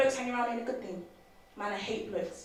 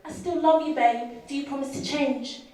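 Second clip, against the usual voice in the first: higher, louder mimicry of a woman in love; a hint of mocking another woman's phone call; lightly voiced pleading girlfriend; 5 semitones higher, 6 dB louder, slower than usual. Women phoning their guy, lightly imitated pleading. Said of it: "half of them call up their guy, go".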